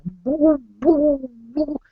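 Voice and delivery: high-pitched mock fretting noises; hammed-up high fussing; stressed-out wife. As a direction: imitate a stressed woman, voice way up. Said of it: "she's all stressed".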